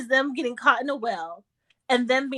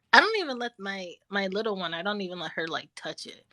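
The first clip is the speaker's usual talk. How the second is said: ordinary talk, nothing marked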